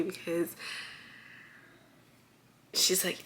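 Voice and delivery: ordinary delivery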